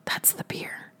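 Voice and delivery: soft hushed whisper. Retold or imitated. Cousin at the coffin whispering the beer can popped. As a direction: whisper it so mourners won't hear.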